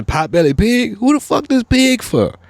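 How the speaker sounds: high, loud, baffled squeal of disbelief; who owns this pig, say it in shock; incredulous outburst. Real, not acted. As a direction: pitch jumping up in disbelief.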